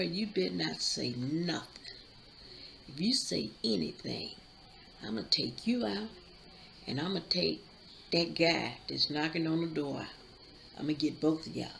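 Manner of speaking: low, quiet menace through the words; menacing hushed threat. Retold or imitated. Father quietly threatening his daughter, hushed menacing threat. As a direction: threaten her under your breath so the knocker can't hear.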